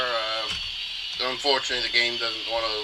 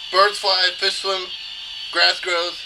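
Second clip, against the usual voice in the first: raised, punchy game quote delivery; Scout from Team Fortress; 6 semitones higher, slower than usual. Acted. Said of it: "quote that the scout says".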